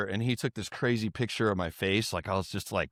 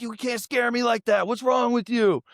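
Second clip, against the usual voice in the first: high, indignant reproach; imitating dead-serious scolding; 11 semitones higher than usual. Rapper upset by the scare, high indignant imitation. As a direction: reproach the friend with pitch well up. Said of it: "He was dead serious".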